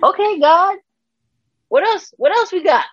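high, laughing-edged questions to God; giddy disbelief. Retold or imitated. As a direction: toss the questions up in delighted disbelief. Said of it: "And I'm like".

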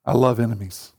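a low, calm divine voice; low voice of God. Retold or imitated. Preacher voicing God to church, low and calm. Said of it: "God goes".

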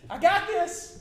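sudden high, loud yell; exasperated teen shouting him off; exasperated outburst. Retold or imitated. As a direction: snap at an over-helpful dad, full volume. Acted exasperated son to dad.

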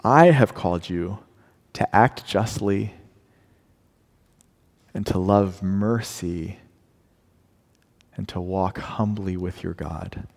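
slow, soft and grave; solemn calm reply. Retold or imitated. Preacher voicing God answering his people gently. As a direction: let each phrase settle. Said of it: "and he says".

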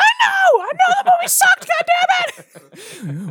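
shrieking falsetto outburst; mock a friend's exasperation in a very high yell; John's old voice. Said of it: "that's how he talked".

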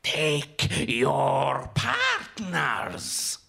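slow, drawn-out growl; menacing growl in a strange accent; growled command. A narrator's reading. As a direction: growl it out like a bird-masked caller, very slowly. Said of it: "He growls in unknown accents".

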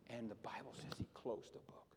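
dramatic whisper to the congregation; hushed whisper. Acted. Preacher whispering the scripture to the church. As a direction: drop to a whisper, let it land.

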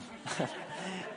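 soft, voiceless chuckle; brief breathy laugh under the breath; quiet laugh. Real, not acted. Admitting he put his head down, quiet laugh.

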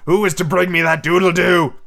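loud, high, furious captain's roar; thundering. A narrator's reading. Hook rallying his dogs, thundering. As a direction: thunder the demand at the crew. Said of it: "he thundered".

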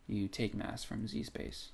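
ordinary delivery

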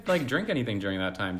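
usual speaking voice

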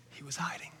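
hushed dramatic whisper. Acted. Preacher whispers to the congregation about hiding. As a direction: drop to a whisper on the reveal.

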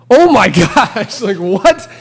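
high, loud, laughing astonishment; laughing disbelief. Retold or imitated. Stunned hiker laughing into the ringing payphone. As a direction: blurt it out through laughter, stunned.

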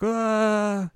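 high, held "ah" of dismay; hammed-up little scream at normal volume; mock startled cry. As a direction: let out a high-pitched wail. Acts Kagome finding clothes stolen.

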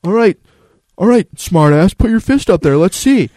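high, loud sarcastic dad daring him; challenging dad. Retold or imitated. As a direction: call his bluff like a skeptical father. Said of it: "And he's like".

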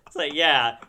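ordinary talk, nothing marked

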